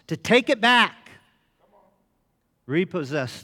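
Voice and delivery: rousing preacher's call; preaching. Acted. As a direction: preach it like a rallying cry. Preaching at full force.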